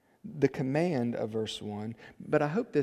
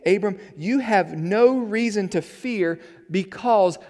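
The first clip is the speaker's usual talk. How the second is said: raised, deliberate pulpit voice; forceful preaching; 6 semitones higher, 8 dB louder than usual. Acted. Preaches God's words to Abram.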